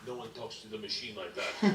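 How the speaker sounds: low, menacing Russian voice; Russian thug voice. Retold or imitated. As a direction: growl it like a mobster. Igor voiced, lower.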